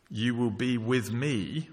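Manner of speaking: slow, with me rising at the end; stress on me. Shown as a demonstration. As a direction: draw out the line slowly and lift the word me. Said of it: "It's personal".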